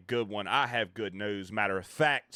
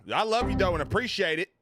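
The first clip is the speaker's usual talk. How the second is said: excited thanks; 10 semitones higher, 7 dB louder than usual. Real, not acted. Thanking chat after alerts.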